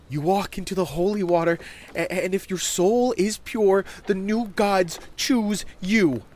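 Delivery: high, loud, slowing into grandeur; excited child reciting sacred lore; fervent young boy. Acted. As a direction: preach it as an awestruck kid. Voices Bobby explaining baptism.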